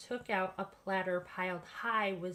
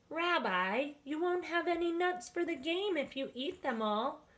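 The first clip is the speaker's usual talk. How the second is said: high, light character voice, gently scolding; raised voice of a small friend teasing the greedy bear; chiding little character; 8 semitones higher than usual. Acted. Baba Brena's friend warns Old Bear about the nuts, high and teasing.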